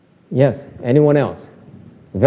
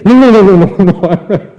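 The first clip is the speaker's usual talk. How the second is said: louder, higher, denial dissolving into laughter; laughing denial; 3 semitones higher, 6 dB louder than usual. Retold or imitated. Teacher hamming up the student's denials, louder and laughing. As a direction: overdo the 'no' until you crack up. Student frantically denies anger.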